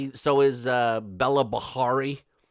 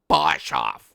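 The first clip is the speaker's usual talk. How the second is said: "Boshoff" dropped far lower and said heavily; deep drawn Boshoff; 4 dB louder than usual. Shown as a demonstration. Host savouring the reporter's name, comic deep voice. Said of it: "I might change my YouTube channel name to that".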